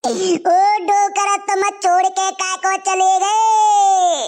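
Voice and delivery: put-on long drawn-out lament for the old man; hammed-up wail, dragging out ओ docker; mock wailing. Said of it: "मत रोवे".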